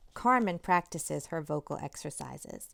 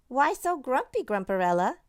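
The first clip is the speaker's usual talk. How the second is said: teasing dad; 5 semitones higher, 6 dB louder than usual. A narrator's reading. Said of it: "asks Carmen's dad".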